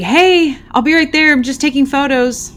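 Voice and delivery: loud, high-pitched call to someone out of sight. Retold or imitated. Investigator calls to whoever is behind her, calling out. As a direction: call it out across the apartment. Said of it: "and I call it".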